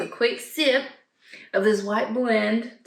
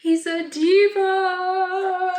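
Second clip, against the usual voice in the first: teasing; 10 semitones higher, 3 dB louder, much slower than usual. Real, not acted. Teasing cat.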